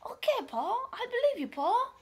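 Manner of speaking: high, eager acted agreement; trusting reply. [name] tells friend Paul he believes him, naively eager. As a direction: pitch it way up, naively going along. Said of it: "And I was like".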